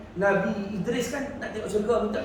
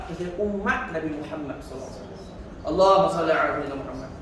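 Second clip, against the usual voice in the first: reverent hush; 6 semitones lower than usual. Real, not acted. Reverent salawat after question.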